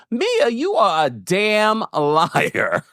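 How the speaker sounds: slow, high, drawn-out accusation; accusing inflection. Retold or imitated. What the daughter's inflection said to Mia, mock accusing. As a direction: stretch it out high as a mock verdict. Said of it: "it was the inflection in her voice".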